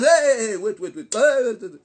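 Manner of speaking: very loud, urgent halting shout; shouted interruption. Acted. Preacher stopping those who join the song, loud shout. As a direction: burst in to stop the singing.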